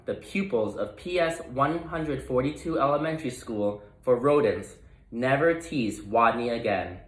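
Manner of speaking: ordinary delivery, nothing marked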